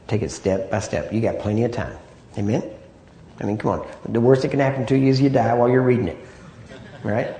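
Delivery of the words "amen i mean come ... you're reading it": ordinary delivery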